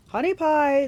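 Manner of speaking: loud, high-pitched monster call; hollered pet name from a monster; monster shouting. A narrator's reading. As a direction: shout it sweetly to someone behind a rock. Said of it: "the monster shouted from behind the boulder".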